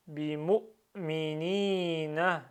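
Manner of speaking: "Bimu'minina" slowly drawn out, long i before na; emphasised prolongations. Shown as a demonstration. Teacher reads in one go, "Bimu'minina" with prolongation stressed. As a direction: hold the last i of "Bimu'minina". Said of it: "J'essaie de souligner les prolongements".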